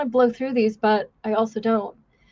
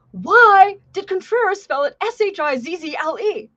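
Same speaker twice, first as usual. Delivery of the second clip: much higher, drill-instructor bark; yelling instructor; 8 semitones higher, 3 dB louder than usual. Retold or imitated. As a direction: call the recruit out like a drill instructor. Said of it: "the instructors yell at Contreras".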